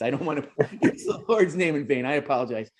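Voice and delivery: ordinary delivery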